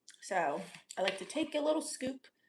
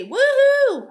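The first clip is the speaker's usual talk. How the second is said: loud high whoop; real woohoo; cheer; 14 dB louder than usual. Real, not acted. Cheering for a hometown.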